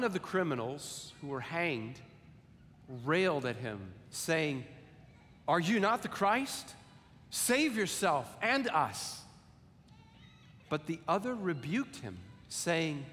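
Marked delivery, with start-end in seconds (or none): 5.5-9.2 mild railing criminal. Acted.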